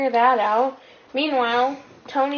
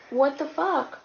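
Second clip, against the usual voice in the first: shocked demand; 6 semitones higher than usual. Retold or imitated. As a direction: retell their shocked outburst, pitched up. Retold shocked reaction to a beheading.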